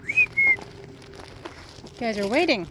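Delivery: slow, high sing-song to the birds; coaxing a wild bird. Real, not acted. Talking to waiting birds.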